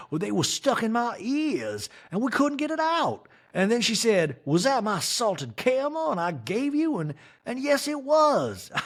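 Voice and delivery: hammed-up Sutton story; mock Sutton. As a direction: gush it like a giddy socialite. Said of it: "Sutton in a talking head".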